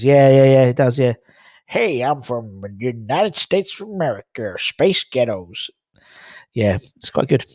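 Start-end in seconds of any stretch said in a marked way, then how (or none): 1.7-5.7 hammed-up American accent. Shown as a demonstration. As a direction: play a caricature American, drawing out the last words. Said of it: "an American accent".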